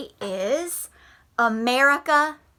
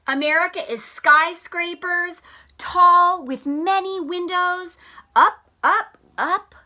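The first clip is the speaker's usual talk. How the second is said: story reading. Acted. Reads picture book aloud.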